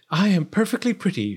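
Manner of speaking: proud storybook princess; vain princess. A narrator's reading. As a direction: preen aloud as a spoiled princess.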